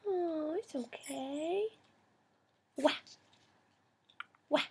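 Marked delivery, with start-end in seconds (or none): none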